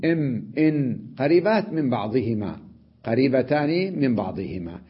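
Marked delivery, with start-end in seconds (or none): 0.0-1.0 إم إن as bare m and n sounds; إم إن isolated, hummed briefly; letter sounds. Shown as a demonstration. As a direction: voice إم then إن to compare places. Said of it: "الميم والنون".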